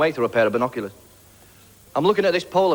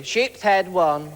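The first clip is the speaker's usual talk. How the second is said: higher, put-on waitress voice; waitress calling an order; 4 semitones higher, much slower than usual. Waitress passing an order to the kitchen, called out. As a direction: call it through to the kitchen.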